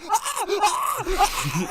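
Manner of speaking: high mock panic shrieks; hammed-up high-pitched panic; panicked screams. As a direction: scream like a terrified clerk. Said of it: "she's panicking and freaking out".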